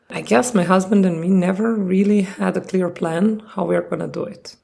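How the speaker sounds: ordinary talk, nothing marked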